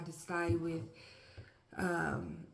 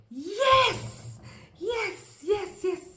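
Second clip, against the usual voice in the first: very high, loud yes cheer; jubilant cheer; 13 semitones higher, 8 dB louder, much slower than usual. Retold or imitated. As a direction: celebrate the win. Lizard reaches top.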